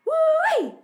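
very high, loud whoop; gleeful yell. A narrator's reading. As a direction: whoop in triumph for your mate. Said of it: "yells Flogger".

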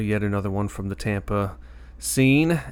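ordinary delivery

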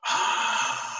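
long voiceless "Ah" breath; drawn-out whispery rush of air; imitated ghostly exhale. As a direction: breathe it out like a released spirit. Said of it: "it sounded like the green things".

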